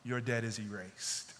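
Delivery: soft, low, tender release; merciful king, softly forgiving the servant's debt; gentle pardon. Retold or imitated. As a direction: let him off the hook gently. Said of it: "So the king says".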